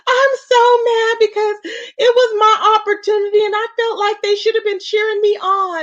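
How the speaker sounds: high, hammed-up imitation of her complaint; mock pretend upset. Nicole still pretending to the chat, mock upset and high. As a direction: mimic her putting on an aggrieved act, pitched high. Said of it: "she came back still pretending".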